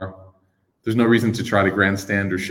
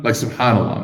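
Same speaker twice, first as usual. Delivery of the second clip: ordinary talk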